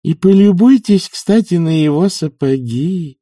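put-on find fault in a high old-woman voice; slow, pointed scorn; critical tone. A narrator's reading. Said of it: "критическим тоном отметила нянюшка".

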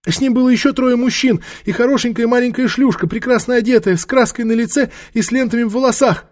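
very high, whining crone voice; frightened old woman. Acted. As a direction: blurt out details in a high whine.

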